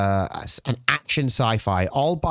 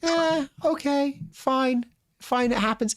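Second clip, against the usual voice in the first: higher, resigned "Eh, okay, fine"; grudging shrug; 13 semitones higher than usual. Retold or imitated. Fan accepting a bad film, resigned and higher. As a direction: shrug it off reluctantly.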